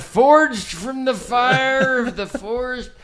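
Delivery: put-on high, slow old-timer voice; creaky grandpa telling a tale; very old man. Continuing the old-timer bit.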